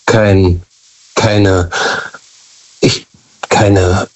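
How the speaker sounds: normal talking voice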